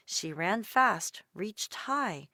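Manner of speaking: ordinary delivery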